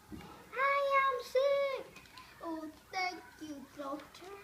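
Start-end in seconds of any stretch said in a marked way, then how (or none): 0.1-2.0 high, loud, very slow delight; overjoyed at being sick; gleeful. Acted. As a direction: savor each word happily. Relieved joy at diagnosis.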